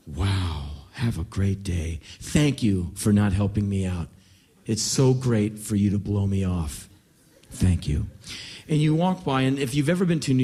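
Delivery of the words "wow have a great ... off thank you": low, measured sarcasm; sarcastic thanks. Acted. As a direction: thank them with a deep, dry sting. Panhandler turned sarcastic after refusal.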